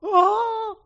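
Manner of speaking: loud, high mock wail; hammed-up sobbing "oh"; imitated sob. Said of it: "I mean, sobbing".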